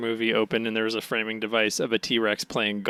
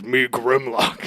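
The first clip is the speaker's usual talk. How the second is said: booming robot dinosaur; slower than usual. Retold or imitated. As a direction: announce it like a giant robot. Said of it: "it just goes".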